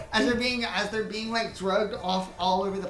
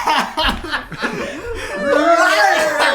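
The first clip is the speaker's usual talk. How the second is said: long, high, loud burst of laughter; 10 semitones higher, 14 dB louder than usual. Real, not acted. Game master failing at horse sounds, helpless laughter. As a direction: crack up helplessly. Cracks up at own horse noise.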